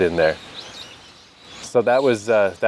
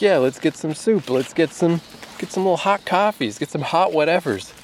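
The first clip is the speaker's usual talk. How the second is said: much higher, playful voice of his cravings; giddy craving; 8 semitones higher than usual. Retold or imitated. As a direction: gush about hot snacks like a kid. Voicing cravings on a rainy day.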